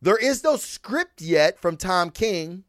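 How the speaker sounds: ordinary talk, nothing marked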